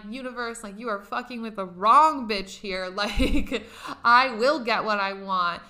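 loud, laughing defiance; defiant anger. Retold or imitated. Venting at the universe after the refusal, loud and defiant. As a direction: dare the universe to stop you. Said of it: "I remember being pissed".

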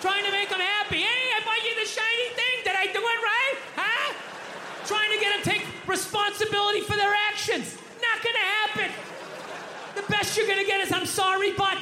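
high-pitched comic tirade, worked up; exasperated rant. Acted. Comic who knows what living with women is like, exasperated rant. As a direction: rant at the top of your range in mock despair.

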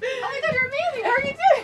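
high-pitched gushing greeting; excited squeal. Real, not acted. Excited greeting of arriving guest.

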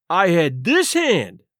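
slow, drawn-out THIS HAND; lightly voiced captain, "this hand" stretched out; indignant stress. A narrator's reading. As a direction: lean hard on "this hand". Captain protests his near miss.

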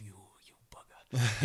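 whispery mock indignation; imitated whispered grumble; whispered curse. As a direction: curse under your breath, as if thinking it. British runner's muttered thought.

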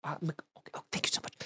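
breathy unvoiced whisper. Shown as a demonstration. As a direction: whisper it through the car window. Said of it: "you almost whisper it to them".

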